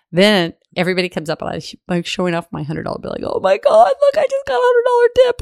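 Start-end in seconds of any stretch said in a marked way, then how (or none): 3.4-5.4 very high, loud excited squeal. Retold or imitated. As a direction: squeal it out, thrilled with the tip. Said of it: "but I was so excited".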